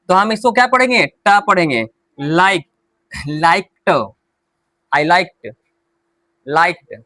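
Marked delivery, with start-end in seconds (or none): 2.2-2.6 Like with a short final k; teacher models the base word Like for students; clipped ending. Shown as a demonstration. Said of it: "बोलचाल में हम". 3.4-3.9 liked with the ed said as a short t, laikt; teacher demonstrates liked to the class, ending in t. Shown as a demonstration. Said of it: "हम लाइक्ड बोल देते हैं". 4.9-6.9 teacher repeats liked so students hear the t; ending like t. Shown as a demonstration. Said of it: "हम लाइक्ड बोल देते हैं".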